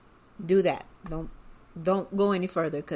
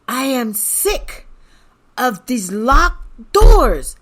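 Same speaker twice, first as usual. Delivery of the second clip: loud, high, drawn-out fed-up outburst; slow, exasperated yell mimicking an angry customer; imitated angry man; 6 semitones higher, 11 dB louder, much slower than usual. Angry man fuming at the motel receptionist, imitated loud and exasperated. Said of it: "He was so mad".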